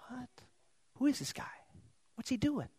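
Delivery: a bystander murmuring in confusion; puzzled murmur. Pretend. Onlooker at the funeral wondering about the stranger, hushed and puzzled. Crowd member watches stranger approach.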